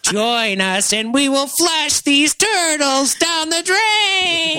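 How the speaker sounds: high slow cartoon villain; menacing alien brain voice; Krang voice.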